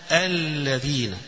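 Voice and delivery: الذين drawn out slowly, lam doubled; slow demo reading. Shown as a demonstration. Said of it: "أصلها كده". (قراءة بطيئة لكلمة الذين بتشديد اللام)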